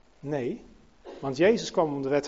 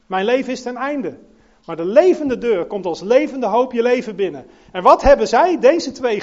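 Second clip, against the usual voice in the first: louder, rousing sermon delivery; preaching; 5 semitones higher, 5 dB louder than usual. Acted. Preacher urging the congregation about the door, rousing sermon. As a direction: build up to full preaching force.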